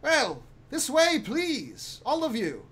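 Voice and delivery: high, drawn-out formal voice; lilting servant, high and measured; gentleman servant. Acted. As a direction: usher them along politely. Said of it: "He says".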